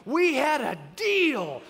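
loud, high, drawn-out protest; hammed-up exasperated yell; indignant dad. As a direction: stretch it out, thoroughly outraged. Dad confronting son at dinner.

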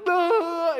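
high, loud slow-motion "no"; hammed-up imitation of a wailing kid; mock wail. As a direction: wail like a movie hero falling in slow motion. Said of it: "'Cause he's wailing".

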